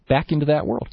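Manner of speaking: ordinary delivery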